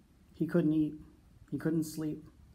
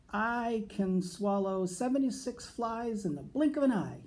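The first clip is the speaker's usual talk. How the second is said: higher, overeager boast; fibbing; 6 semitones higher than usual. A narrator's reading. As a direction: oversell the lie, pitched up. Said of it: "he fibbed".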